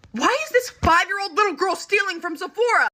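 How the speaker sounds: dramatized gasping outrage; incredulous outburst. Retold or imitated. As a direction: exclaim it in scandalized shock. Sisters' silent shock acted out loud.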